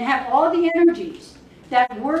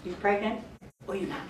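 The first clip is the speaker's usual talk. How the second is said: ordinary talk, nothing marked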